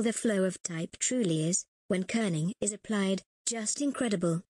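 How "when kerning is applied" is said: usual speaking voice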